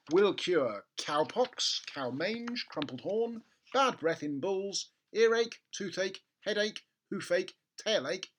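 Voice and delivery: high, slow official label voice; label read. Acted. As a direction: read it out like a pompous notice. Reading the label aloud.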